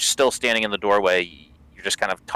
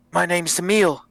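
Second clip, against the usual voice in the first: prisoner voice; 9 semitones higher than usual. Acted.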